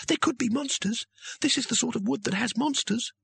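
very high, anxious voice; nervous young wizard. A narrator's reading. Youngest wizard warning of monsters, nervous. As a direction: fret in a high, thin whine.

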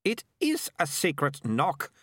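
high, slow, insistent retort; testy character voice, firmly emphatic; snapped. A narrator's reading. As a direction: contradict sharply, leaning on "is". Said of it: "Melicia snapped".

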